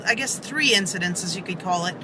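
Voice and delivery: ordinary delivery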